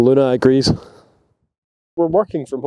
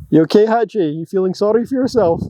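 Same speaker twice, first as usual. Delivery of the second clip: higher, sing-song pet talk; 6 semitones higher than usual. Real, not acted. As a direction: owner cooing over her sick dog Hatchie. Talking to the sick dog.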